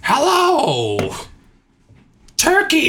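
very high, drawn-out comic call; Gilbert Gottfried. Acted. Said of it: "Gilbert Gottfried's on there".

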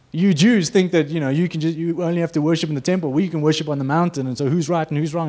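mock the challenger's sass, lightly; cheeky attitude. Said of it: "a bit cheeky with him".

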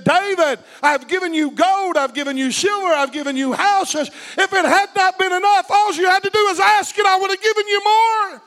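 loud, high booming voice of God; God speaking. Acted. God confronting David, booming and rising. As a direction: rebuke David with rising force. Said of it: "God came to him and he said".

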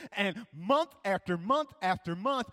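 ordinary talk, nothing marked

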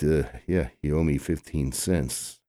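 normal talking voice

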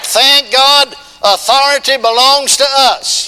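loud, high, slowed preaching cry. Acted. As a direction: proclaim it to the whole church. Preacher building to full force.

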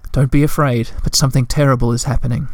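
usual speaking voice

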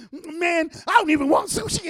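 mock alarm, high, loud and quick; panicked backing down. Man seeing the second guy, hasty high-pitched retreat.

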